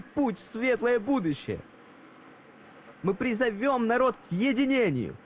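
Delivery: high, loud call to the crowd; rallying speech. Acted. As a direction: rouse the crowd like an orator. Speech rallying crowd, much higher, louder.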